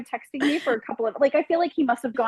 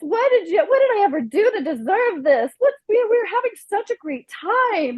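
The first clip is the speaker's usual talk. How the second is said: higher, whiny fake crying plea; mock sobbing; 6 semitones higher than usual. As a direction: imitate a guy sobbing and pleading. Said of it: "He comes out after me sobbing".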